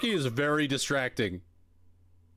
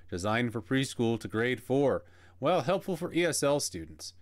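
upbeat commercial announcer voice; ad read; 4 semitones higher than usual. Acted. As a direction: sell it like an old software ad. Reads the game's ad blurb.

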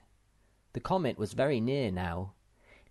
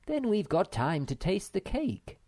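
motherly figure; 9 semitones higher than usual. Acted. Narrator voices Moomin Mama.

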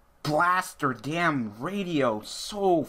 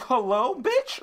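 sassy comeback; 13 semitones higher, 3 dB louder, slower than usual. Retold or imitated. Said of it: "I'm like".

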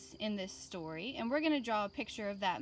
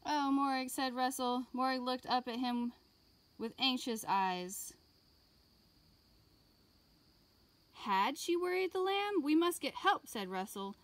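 put-on lively read-aloud for young children; gentle classroom story voice; storybook reading; 4 semitones higher than usual. A narrator's reading. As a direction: read it like a picture book to kids. Reading picture book to class.

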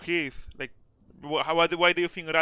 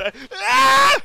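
startled scream; 12 dB louder than usual. Real, not acted. Ambushed in game.

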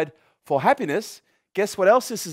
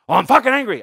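mock angry; 5 semitones higher, 7 dB louder, faster than usual. Voicing angry person.

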